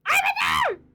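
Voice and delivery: high loud cartoon squeal; squeaky shriek. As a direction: squeal it out in mock panic. Shrieking again at the bird.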